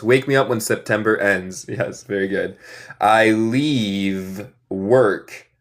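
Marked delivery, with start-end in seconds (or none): 3.0-5.5 slow American English with a flapped T; American accent. Shown as a demonstration. Teacher showing his American accent, slow. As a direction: say it slowly in an American accent, dropping the final T. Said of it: "Note mi acento".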